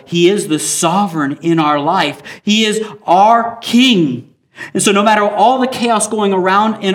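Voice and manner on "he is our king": slow, emphatic stress on King; louder, drawn-out preaching climax; emphatic declaration. Acted. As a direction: lean hard into King, slowly.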